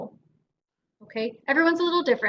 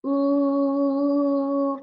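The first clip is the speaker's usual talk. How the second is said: siren ooh; 3 semitones higher than usual. Shown as a demonstration. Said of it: "what we're doing is we're saying".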